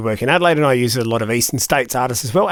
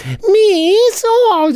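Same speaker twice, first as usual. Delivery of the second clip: put-on high, loud drawn-out kid voice; kid drawing out vowels; 3 dB louder than usual. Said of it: "this kid's drawing out all his vowels".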